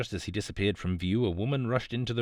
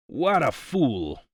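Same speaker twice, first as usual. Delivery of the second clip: roar; 3 semitones higher, much slower than usual. A narrator's reading. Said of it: "roared the big Italian".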